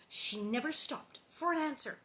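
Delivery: ordinary delivery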